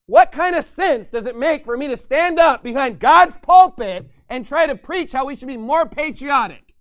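loud, high pulpit preaching; fiery preaching. Acted. Pastor denouncing patriotic preaching with loud fiery preaching. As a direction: thunder it from the pulpit.

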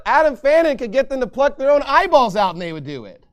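usual speaking voice

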